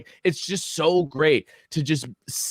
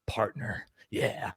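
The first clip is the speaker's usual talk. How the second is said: unvoiced, dramatic movie whisper, much softer; action-movie whisper; 4 semitones lower, 11 dB quieter, slower than usual. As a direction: breathe it like the hero's tender closing line. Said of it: "typical action movie scene where it's like".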